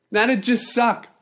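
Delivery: ordinary talk, nothing marked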